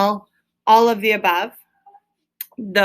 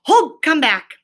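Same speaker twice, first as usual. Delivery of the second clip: higher, louder eager calling; excited calling; 5 semitones higher, 4 dB louder than usual. A narrator's reading. Mole eagerly calls Rat back, excited and loud. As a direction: urge him back, bubbling over. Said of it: "full of joy and excitement".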